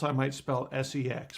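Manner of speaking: ordinary talk, nothing marked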